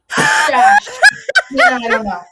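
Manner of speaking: high, loud laughing shout; real laugh cracking the word wide open; shrieking with laughter. Real, not acted. Host ranking viewers, bursting into high shrieking laughter.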